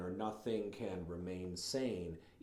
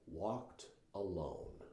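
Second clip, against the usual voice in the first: very slow, soft "walked alone"; 2 semitones lower, 6 dB quieter, much slower than usual. Shown as a demonstration. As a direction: let "walked alone" fade out. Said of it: "read that slowly".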